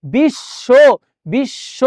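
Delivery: বিশ্ব as "bish-sho", sh held long; doubled consonant. Shown as a demonstration. Teacher showing ব ফলা doubling, syllable-by-syllable বিশ্ব. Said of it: "দ্বিত্ব হয়".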